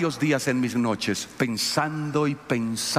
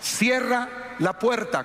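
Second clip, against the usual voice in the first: slow, much higher final order; third warning; 9 semitones higher, slower than usual. Retold or imitated. Pastor tells girl a third time to close door, slow stern order. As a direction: spell it out, last warning. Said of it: "Le dije".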